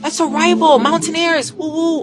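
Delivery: a touch of fake-friendly fan; chummy small talk. Shown as a demonstration. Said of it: "build a relationship".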